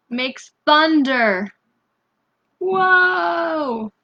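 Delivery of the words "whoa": a high, loud, wide-eyed whoa; big hammed-up whoa for the kids; amazed cry. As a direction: gasp out loud at the thunder. Reacting to thunder in picture book.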